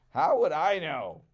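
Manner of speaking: loud, high careless vulture; defiant shrug. Acted. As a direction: brush off the question loudly. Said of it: "he answered".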